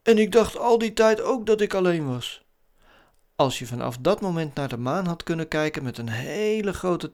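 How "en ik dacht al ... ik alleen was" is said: hoog personagestemmetje; vertellend ruimtewezen. A narrator's reading. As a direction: deel het als nieuw vriendje. Voices character's reply. (hoge stem uit kinderverhaal)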